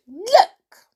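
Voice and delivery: high, loud "look!"; excited cry. A narrator's reading. Amelia showing off the ink bottle to the toys, high and loud. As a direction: call the toys to look, thrilled. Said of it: "she cried".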